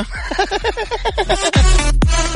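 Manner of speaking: high burst of laughter; loud laugh. Real, not acted. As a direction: crack up at the fumble. Host laughs at own fumble.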